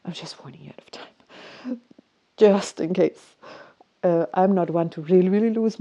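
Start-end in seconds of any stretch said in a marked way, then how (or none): 0.1-3.3 hushed warning. Retold or imitated. Whispering a polite warning to the call center guy. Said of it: "And I said it just like this".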